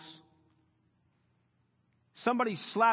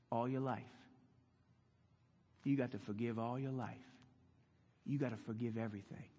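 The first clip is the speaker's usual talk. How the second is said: much softer, lower and calm; gentle answer; 9 semitones lower, 10 dB quieter than usual. Retold or imitated. Jesus answering Peter, soft and patient. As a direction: settle it quietly like a patient teacher.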